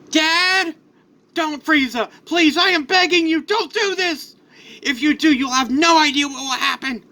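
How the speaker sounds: high, urgent acted begging; frantic pleading. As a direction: plead desperately for your son's life. Pleading for son's life.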